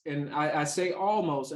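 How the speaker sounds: ordinary talk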